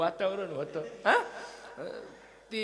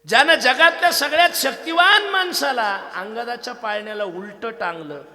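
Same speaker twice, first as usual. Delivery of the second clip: much higher, much louder sermon proclamation; full-force preaching; 6 semitones higher, 13 dB louder than usual. Acted. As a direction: proclaim it to the crowd. Preaching emphasis. (कीर्तनकाराचा जोशपूर्ण, मोठ्या आवाजातील उपदेश)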